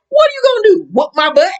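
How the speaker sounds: very high-pitched imitation of a challenge; hammed-up squeaky challenge; mock taunt. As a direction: dare them in a high, mocking voice. Acting out Mary taunting Jen.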